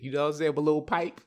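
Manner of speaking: normal talking voice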